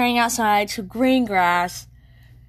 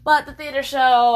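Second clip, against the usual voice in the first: normal talking voice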